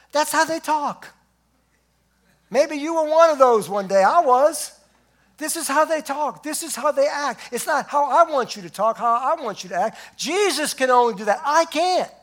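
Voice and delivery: loud, high, driving sermon peak; full-force preaching. Acted. Preacher exhorting the church, loud and high. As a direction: preach it hard to the congregation.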